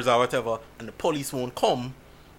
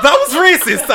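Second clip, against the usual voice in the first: high and loud, half laughing; shocked realization; 12 semitones higher, 10 dB louder than usual. Retold or imitated. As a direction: blurt it out in disbelief. Friend's realization, retold laughing.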